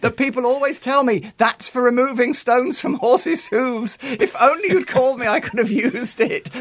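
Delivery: sending up her own eagerness in a squeaky mock voice; mock high-pitched self. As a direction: play the eager penknife owner, way up high. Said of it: "And I said".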